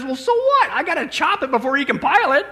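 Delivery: high-pitched, indignant comic comeback; exasperated eye-roll. Acted. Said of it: "Joey just rolls his eyes".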